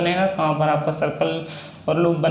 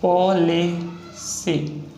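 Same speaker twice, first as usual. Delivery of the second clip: Policy drawn out very slowly while writing; slow dictation; much slower than usual. Shown as a demonstration. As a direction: stretch policy out syllable by syllable as you write it. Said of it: "next example है policy".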